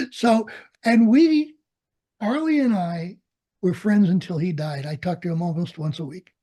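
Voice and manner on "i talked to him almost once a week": ordinary delivery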